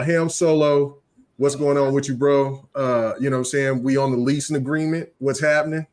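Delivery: usual speaking voice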